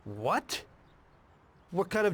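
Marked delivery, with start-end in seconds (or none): 0.0-0.6 low start, sharp questioning rise; disbelieving one-word question; taken aback. Acted. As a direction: thrown by the plan. Told they will blow it up.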